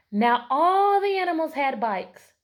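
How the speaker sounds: loud, slow read-aloud; lively picture book narration; storybook narration. A narrator's reading. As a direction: read it joyfully to the class.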